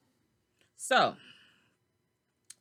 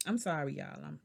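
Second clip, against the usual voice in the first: ordinary talk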